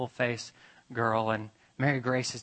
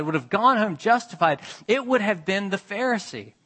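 higher and louder, building preacher's cry; preaching at full force; 8 semitones higher, 7 dB louder than usual. Acted. Preacher praising the Pharisee to the congregation, full force. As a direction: lift it up and drive it home to the congregation.

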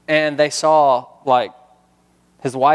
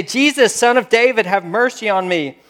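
raised, higher pleading call; crying out; 8 semitones higher, 3 dB louder than usual. Acted. Blind beggar calling to Jesus over the crowd, crying out. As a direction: cry out loudly for mercy. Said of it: "he began to cry out".

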